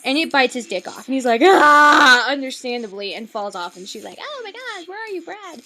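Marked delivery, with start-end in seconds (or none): 1.4-2.3 loud "ah" of agony, acted out; mock pain scream. Imitating man's scream when bitten. 4.2-5.6 high, soft imitation of a distressed girl; mock distress. Voicing girl looking for Brad.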